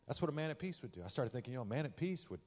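usual speaking voice

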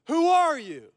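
high-pitched, drawn-out challenge; yelling centurion. Acted. Centurion questioning a disoriented rabbi who won't respond, yelling. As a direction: holler it like a guard at the gate. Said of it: "So the centurion again goes".

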